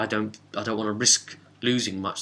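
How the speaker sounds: normal talking voice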